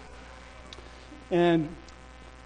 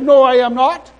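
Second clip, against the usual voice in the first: loud, raised flat refusal; defiant retort; 6 semitones higher, 11 dB louder than usual. Retold or imitated. Preacher refusing deportation, loudly defiant. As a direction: push back hard at the official. He defies the angry official.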